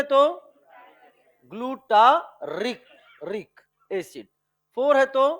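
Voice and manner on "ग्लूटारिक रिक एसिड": Glutaric very slowly, "rik" split off and repeated; slow, broken-up glutaric for a class; syllable drill. Shown as a demonstration. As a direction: stretch ग्लू-टा-रिक, then echo the रिक. Teacher drills the contrasting ending.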